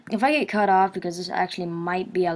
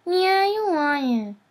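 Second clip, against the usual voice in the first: very high, drawn-out squeaker voice; 11 semitones higher, 4 dB louder, much slower than usual. Shown as a demonstration. Answering a friend who asked for it, squeaker voice. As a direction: stretch it out in a high put-on squeak. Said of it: "use your squeaker voice".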